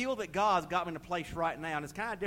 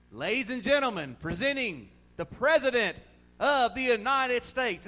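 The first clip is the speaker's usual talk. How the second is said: louder, higher announcer call; mock announcer; 7 semitones higher, 7 dB louder than usual. Preacher playing the announcer introducing the President, booming.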